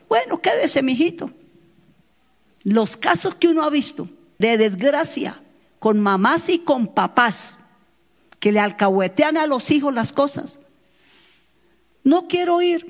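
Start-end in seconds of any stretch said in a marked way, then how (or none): 0.1-1.3 higher, lightly mocking give-in; imitated indulgent mother. Mother lets her reluctant child stay behind, mock indulgent. 12.0-12.8 higher-pitched mock refusal; imitated reluctant child. Child tells mother they won't go, mimicked in higher voice.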